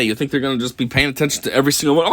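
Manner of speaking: normal talking voice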